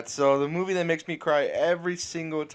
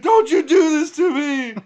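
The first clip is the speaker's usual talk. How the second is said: high, loud mock-crying plea; hammed-up anguished yell; Ben Affleck; 13 semitones higher, 13 dB louder than usual. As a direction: cry it out like a desperate movie hero. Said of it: "fucking Ben Affleck's crying".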